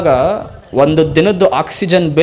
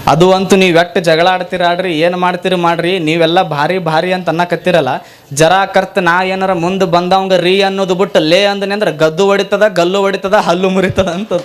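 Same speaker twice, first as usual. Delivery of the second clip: ordinary talk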